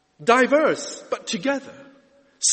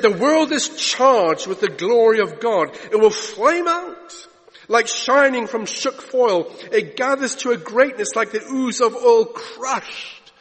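poetry recitation; 2 semitones higher, 4 dB louder than usual. Acted. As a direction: declaim the verse with ringing weight. Recites Hopkins poem.

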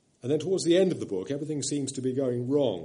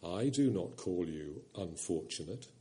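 low voice of the lion; 6 semitones lower, 8 dB quieter than usual. A narrator's reading. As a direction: rumble softly like a kind great lion. The Voice of Aslan.

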